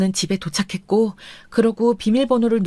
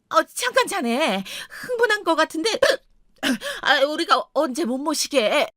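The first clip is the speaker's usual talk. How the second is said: high, placating in-law voice; hesitant protest, pitched up; flustered in-law; 8 semitones higher than usual. Acted. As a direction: try to calm an angry son-in-law, flustered.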